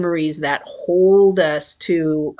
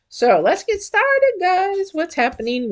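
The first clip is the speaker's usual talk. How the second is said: excited; 13 semitones higher than usual. Real, not acted. Kicking off the reading.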